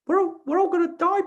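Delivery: very high squeaky cartoon mouse; high cartoon mouse voice, loud and silly; Mickey Mouse voice. Shown as a demonstration. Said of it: "in Mickey Mouse's voice".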